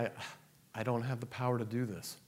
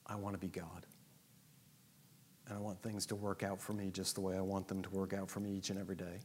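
quiet prayer; 5 semitones lower, 8 dB quieter than usual. Acted. Prays honestly to God as Daddy.

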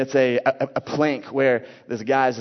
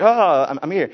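mock gasp of coming to, louder and higher; jolted awake; 4 semitones higher, 6 dB louder than usual. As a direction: play a patient shocked back to life. Acts a patient jolted awake.

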